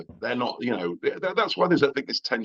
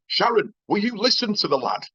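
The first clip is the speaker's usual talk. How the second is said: voicing the fed-up husband; exasperated husband; 11 semitones higher than usual. Husband begging drunk wife to listen, exasperated. West Ham man scolding wife.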